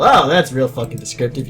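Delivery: plain reading voice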